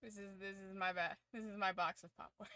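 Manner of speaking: ordinary talk, nothing marked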